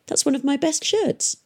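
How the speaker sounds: high-pitched, mildly indignant protest; indignant boy. A narrator's reading. Boy objects to girl tearing up his shirt, indignant.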